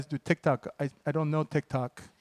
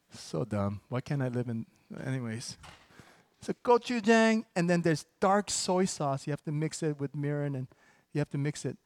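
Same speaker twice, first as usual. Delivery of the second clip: normal talking voice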